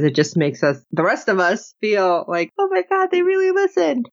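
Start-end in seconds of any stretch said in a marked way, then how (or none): 2.6-4.1 high-pitched fan amazement; thrilled mock fan reaction; acted delight. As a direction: gush it in a much higher voice. Voicing delighted fans' reaction.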